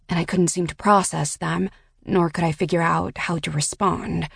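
ordinary delivery, nothing marked

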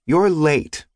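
put-on high, cross little girl. A narrator's reading. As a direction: pout it like a sulky little girl. Child scolds late father.

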